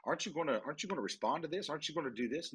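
hurried worried team member pressing the boss; urgent employee. Retold or imitated. Team pressing the boss to respond, quick and anxious. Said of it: "my team will say".

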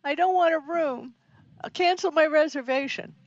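high, frantic pleading; panicked. Retold or imitated. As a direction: beg to cancel it. Panicked guy.

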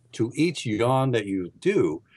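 ordinary talk

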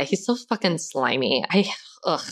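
usual speaking voice

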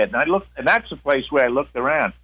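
normal talking voice

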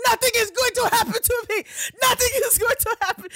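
very high, loud mock panic; retold screaming. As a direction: shriek it like the spooked driver, hammed up. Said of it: "he just starts screaming".

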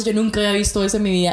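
ordinary talk, nothing marked

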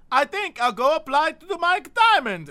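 put-on chirpy young job seeker; light imitation of Jose. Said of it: "It's Jose, like".

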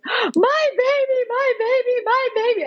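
very high, drawn-out mock blubbering; imitated sobbing. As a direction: wail it as an overwhelmed new mother. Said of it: "literally screaming, blubbering idiot, like, sobbing".

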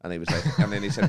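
ordinary delivery

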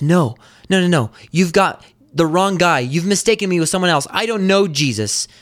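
high, hurried protest; acted denial. Peter answers his accuser a second time, acted denial. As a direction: imitate someone insistently brushing off an accusation.

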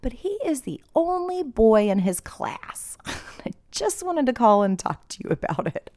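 a hint of a careful administrator breaking news; mild mock school-official voice; school head quoted. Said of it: "called me to say".

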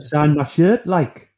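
high Geordie fan voice; loud Geordie, sign my shirt like; Geordie accent. Retold or imitated. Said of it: "they'd say it in their old Geordie accent".

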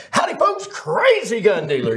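high, hammed-up folksy greeting; big persona. Shown as a demonstration. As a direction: throw out a big cowboy howdy. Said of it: "that is a persona".

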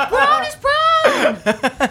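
very high, drawn-out comic cry; hammed-up high-pitched comedian voice; Jerry Seinfeld. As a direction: stretch the words in a squealed sitcom impression. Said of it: "in a Jerry Seinfeld voice".

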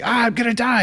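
high, frantic mock panic; panicked body voice. Mock panic of the body running out of air. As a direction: voice the body's alarm, high and gasping. Body's panic voice in breathing story.

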